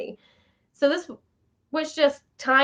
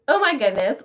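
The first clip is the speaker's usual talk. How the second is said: ordinary talk, nothing marked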